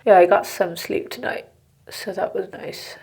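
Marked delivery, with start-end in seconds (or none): 1.9-3.0 soft unvoiced whisper. Real, not acted. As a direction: whisper it as a private aside. Quiet aside about sleep.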